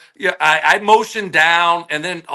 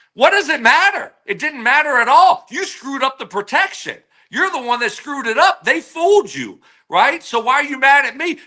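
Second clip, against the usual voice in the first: higher, exasperated protest; raised-pitch mock outrage; retold indignation; 7 semitones higher than usual. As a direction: argue back that it wasn't your fault. Retold argument with quarterback.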